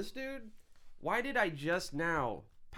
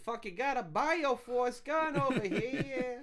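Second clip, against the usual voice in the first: hammed-up Boston accent, higher and slower; 10 semitones higher than usual. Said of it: "Sounds like something Boston would say".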